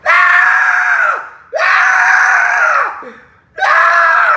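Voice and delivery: long high-pitched mock shrieks; girlish screams. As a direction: shriek at full volume like a girl. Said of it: "screaming like a girl".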